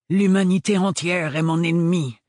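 loud villainous hiss, spat out; hissed through teeth. Acted. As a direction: hiss it like a mustache twirling villain. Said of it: "siffla-t-il entre ses dents".

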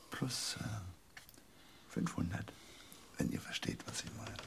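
hushed, secretive bet; conspiratorial whisper. Acted. Player whispering his raise to the table, hushed. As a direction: lean in and breathe the raise.

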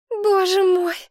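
high, loud cry of alarm; exclaiming. A narrator's reading. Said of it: "воскликнула Мария". (испуганное восклицание, высоко и громко)

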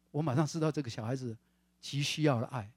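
ordinary talk, nothing marked

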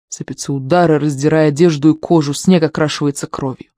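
usual speaking voice

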